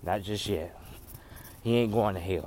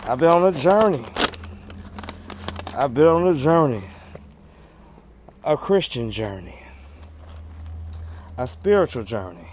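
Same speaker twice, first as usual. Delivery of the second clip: preaching; 3 semitones higher, 5 dB louder than usual. Acted. Preaching declaration.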